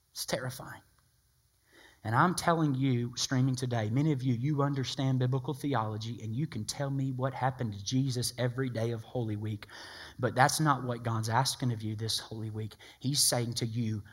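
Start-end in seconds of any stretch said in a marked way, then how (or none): none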